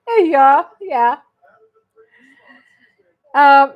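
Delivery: ordinary talk, nothing marked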